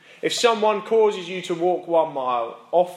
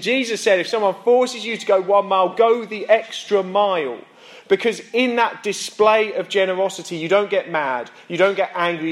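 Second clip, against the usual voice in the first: louder, higher, driving sermon climax; preaching at full force; 4 semitones higher, 6 dB louder than usual. Acted. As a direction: build the point and hammer it home.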